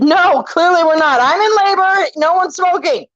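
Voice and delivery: mother in labor telling nurses off, exasperated. Retold or imitated. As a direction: spell out the obvious, annoyed.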